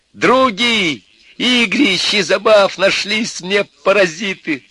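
high, loud, drawn-out yell; stretched, furious high cry; angry shouting. A narrator's reading. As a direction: hurl the insult at the top of your voice. Tearful Костя keeps yelling after them.

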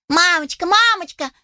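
high excited calling out; shouting chicks. Acted. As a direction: call out eagerly like chicks. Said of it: "закричали малыши в один голос".

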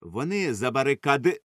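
plain reading voice

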